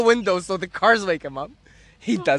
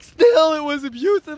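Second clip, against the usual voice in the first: high, loud first word, then easing; aggrieved retort; 7 semitones higher, 4 dB louder than usual. Retold or imitated. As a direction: imitate an indignant comeback. Acted quarrel, retort.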